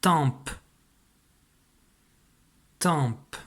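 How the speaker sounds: "Tempe" ending on an audible P, as in papa; final P sounded. Shown as a demonstration. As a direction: say "Tempe" for dictation, letting the final P be heard. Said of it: "Tu entends bien le P de papa à la fin". (« Tempe » avec un P final bien audible)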